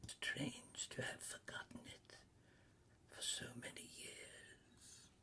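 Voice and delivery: very soft, whispered ghost voice; hushed spirit. A narrator's reading. Ghost musing on Scrooge's forgotten years, whispered. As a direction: let it drift out, barely voiced.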